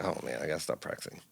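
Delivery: low, quick, very soft throwaway; a resigned joke under the breath; deadpan mutter. Retold or imitated. Guitarist joking after the sloppy take works, low and mumbled.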